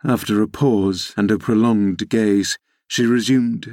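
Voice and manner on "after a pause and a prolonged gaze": ordinary delivery, nothing marked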